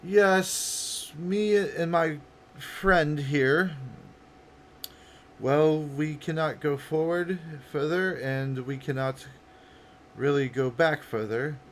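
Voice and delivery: projected, measured female role; female character. Acted. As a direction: explain a predicament carefully in a woman's voice. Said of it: "you hear the female's voice".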